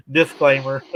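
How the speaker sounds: disclaimer said through a laugh. Real, not acted. Joking warning to friends, laughing. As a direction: repeat the word while cracking up. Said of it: "Di-, disclaimer".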